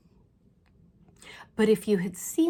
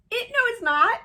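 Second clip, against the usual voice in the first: very high, loud squeal of denial; mock-wail your disbelief, loud and squeaky; dismayed protest; 10 dB louder, faster than usual. Her own dismayed protest, retold.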